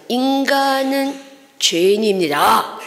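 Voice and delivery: each word slowly placed; slow solemn recital. Retold or imitated. Preacher reciting the tract to the gangster, slow and solemn.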